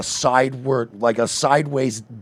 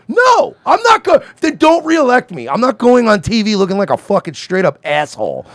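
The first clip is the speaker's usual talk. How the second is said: much higher, yelling refusal; outraged shout; 6 semitones higher, 3 dB louder than usual. Retold or imitated. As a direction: blow up in loud indignant refusal. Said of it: "would go".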